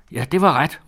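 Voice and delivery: high, loud, stung outburst; offended shout. A narrator's reading. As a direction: cry out as if deeply insulted. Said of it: "råbte tante". (højt, krænket udbrud)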